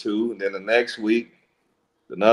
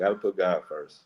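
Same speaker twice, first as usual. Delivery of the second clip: the same line again, softer and lower, to herself; soft echo; 3 semitones lower, 5 dB quieter than usual. Pretend. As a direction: let it fall away like an afterthought. Echoes own line, softer.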